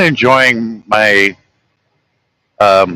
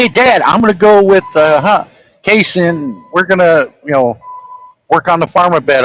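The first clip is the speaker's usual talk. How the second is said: higher, lightly voiced teen son asking dad; a light boyish voice asking permission; voicing his son; 6 semitones higher than usual. Dad retelling how his son asked to go farm. Son's voice in retold talk.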